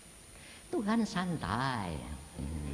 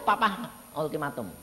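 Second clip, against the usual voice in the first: usual speaking voice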